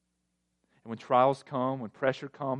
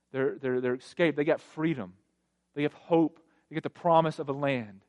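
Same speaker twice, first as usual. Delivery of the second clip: ordinary delivery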